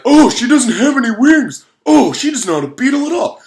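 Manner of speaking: high, loud mocking crowd voice; high beetles scorning her; snobby beetles. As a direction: sneer it out loud.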